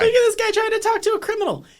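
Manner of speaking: high, fast, loud mock outrage; incredulous high-pitched jab; mocking exclamation. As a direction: scoff at him in a squeaky voice. Mocking exclamation about the character.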